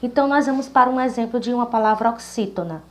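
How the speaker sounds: ordinary delivery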